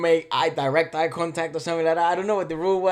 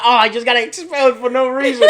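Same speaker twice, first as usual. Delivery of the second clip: laughing disbelief; 8 semitones higher, 13 dB louder than usual. Retold or imitated. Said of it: "And I'm like".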